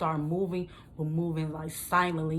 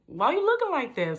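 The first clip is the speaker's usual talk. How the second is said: higher, louder questioning salesman; 8 semitones higher, 4 dB louder than usual. Retold or imitated. Salesman asking her about her face, higher. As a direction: lightly voice the car salesman.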